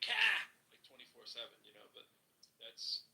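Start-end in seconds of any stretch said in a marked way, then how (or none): 0.0-0.4 loud, high Boston "Car!"; mock Boston accent. Mocking how people think Bostonians talk, shouted Boston accent. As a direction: blurt "Car!" in exaggerated Bostonian. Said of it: "people aren't going like".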